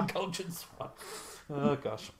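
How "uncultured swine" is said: mock insult. Mock insult over pronunciation.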